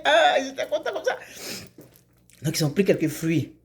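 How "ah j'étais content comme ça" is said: breathless happy laugh; joyful laugh, high and breathless. Acted. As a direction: laugh through the words with joy. Joy after the dance.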